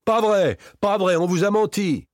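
loud, very high protest; outcry of denial. A narrator's reading. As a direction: cry out in panicked denial. Victor cries out a denial.

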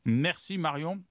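talking with her mouth full. Real, not acted. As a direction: say it around a mouthful of cake. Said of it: "j'ai la bouche pleine".

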